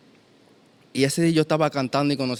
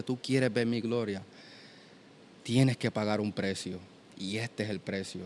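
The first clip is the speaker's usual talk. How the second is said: softly spoken divine voice, sinking quieter; Holy Spirit's voice; 3 semitones lower, 8 dB quieter than usual. Retold or imitated. God answering his prayer for glory, quiet solemn voice. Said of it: "sentí la voz del Espíritu Santo".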